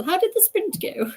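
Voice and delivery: a touch of a posh lady, lifted; lightly posh, sensible voice; playfully going posh; mock posh. Shown as a demonstration. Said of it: "I don't know why I went posh with my sensible".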